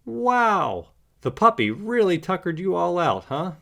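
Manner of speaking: smiling, playful surprise at the sleepy pets; amused surprise. A narrator's reading. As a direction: owner finding the pets asleep, amused surprise. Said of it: "Bob says with a smile".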